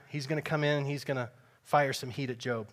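ordinary talk, nothing marked

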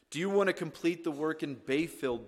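normal talking voice

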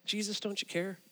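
softly voiced, searching question; hushed and personal, as if praying; quiet plea. Believer in a trial asking Jesus, quiet plea.

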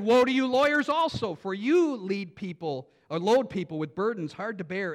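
high, loud woe to the lawyers; loud prophetic denunciation; indignant rebuke. Acted. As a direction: blast the woe. Reading Jesus' rebuke.

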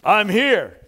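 loud, eager hail; eager retold greeting; excited. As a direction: call out to the captain, excited. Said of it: "He's so excited".

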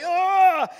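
loud, high groan of discomfort; awkward groan. Retold or imitated. Preacher stared at by the whole plane, awkward loud groan. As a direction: let out a squirming, high groan.

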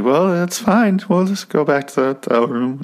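wife meekly backing down to her husband. Retold or imitated. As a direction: play the timid wife giving in. Meek wife voice.

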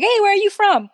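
high, loud tourist calling out; random stranger. Retold or imitated. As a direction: hail someone as a nosy stranger. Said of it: "you have some random pe-, person like".

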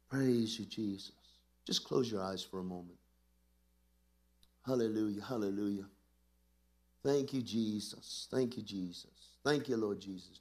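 low, repeated murmurs of praise; worshipful praise. Real, not acted. Praise and prayer before congregation.

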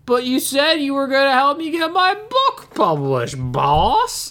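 high, loopy Southern drawl dropping on "boss"; botched dumb-henchman voice turned Southern; accidentally Southern henchman. Retold or imitated. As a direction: whine it like a Southern lackey. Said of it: "that, that turned Southern".

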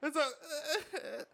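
nervous laugh breaking in. Real, not acted. Laughing off convention stress.

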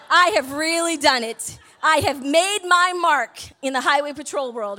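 slow, loud, drawn-out declaration; mock-grand. Stranded driver owning her embarrassment to the crowd, mock-grand. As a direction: proclaim it slowly and loudly, like a dubious achievement.

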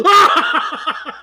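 high loud mocking cackle; mocking laugh burst. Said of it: "He, like, laughs in his face".

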